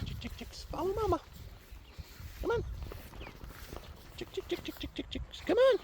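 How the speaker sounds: soft high chick-chick calling; whispered high coaxing to chicks; calling chickens. Real, not acted. Calling chicks to follow her.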